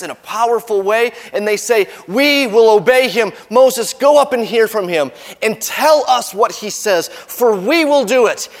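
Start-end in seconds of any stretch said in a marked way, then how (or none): 2.1-8.5 high, loud voice of the people; raised, insistent vow; eager promise. Acted. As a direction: pledge obedience with mock eagerness.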